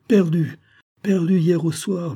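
plus haut et plus fort, lent, plein de douleur; douleur éclatante. A narrator's reading. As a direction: crier la perte du navire. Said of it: "en laissant éclater une vive douleur". (douleur vive et lente)

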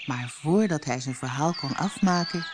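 plain reading voice

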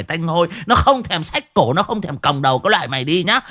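high, fast tirade of curses; angry old village woman cursing a thief; ranting village woman. Acted. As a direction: scold relentlessly, pitched well above normal. Voices a scolding woman.

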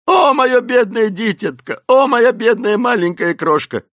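high, loud hammed-up wailing; wailing lament. A narrator's reading. Esmeralda mourning her poor little one, wailing. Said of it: "снова разразилась несдерживаемыми рыданиями".

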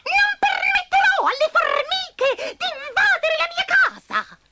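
high, loud refusal; angry spider. Acted. Spider refuses to let ants in. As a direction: slam the door on them.

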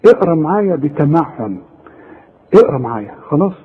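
ordinary delivery

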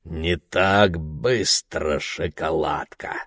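put-on slow, leering English accent. A narrator's reading. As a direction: drawl it in a heavy English accent. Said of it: "с сильным английским акцентом".

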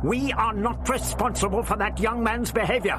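high-pitched indignant denial; snapping back. A narrator's reading. Doctor disowning Ian's escape, snapping back. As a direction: deny it sharply, pitched high. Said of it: "he snapped back".

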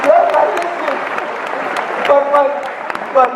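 loud incredulous excitement; excited. Real, not acted. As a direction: marvel loudly at the scene. Excited describing chef scraping plate.

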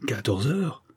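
incredulous echo question, rising at the end; understated astonishment with an upward lilt; astonished exclamation. A narrator's reading. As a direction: query it in disbelief, without raising the volume. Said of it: "s'écria le comte".

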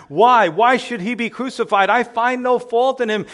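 desperate governor cries out one last time; desperate cry. Acted. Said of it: "in desperation, crying out one final time".

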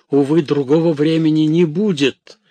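high, loud desperate cry. A narrator's reading. Theodore pleads there is no other time, crying out. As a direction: raise your voice in urgent protest. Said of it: "кричал Теодор".

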